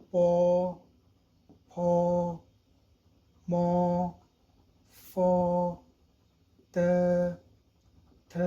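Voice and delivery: each initial sounded out slowly like a tutor; crisp Mandarin-style syllables, one by one; Chinese initials drilled. Shown as a demonstration. Teacher reciting Chinese initials for Urdu learners, each sound isolated. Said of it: "अब initials".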